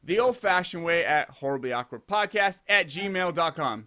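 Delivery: normal talking voice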